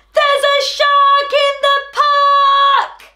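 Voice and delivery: high, slow, sung-out yell; yell with all his might. A narrator's reading. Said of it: "Timothy yells with all his might".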